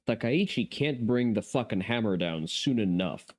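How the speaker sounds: ordinary delivery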